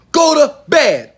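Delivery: high, loud, drawn-out order; retold scream. Father sending his daughter to bed, screaming. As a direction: yell it slowly, each word punched. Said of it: "Why did I scream at my daughter?".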